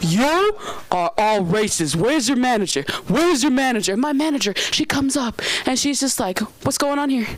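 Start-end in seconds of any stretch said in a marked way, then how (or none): 0.0-4.0 mock yelling. Customer demands the manager, mock yelling. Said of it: "she's yelling, she's screaming". 6.6-7.3 higher-pitched imitation of a fired-up manager; confrontational manager. Manager confronts the angry customer, mock confrontational.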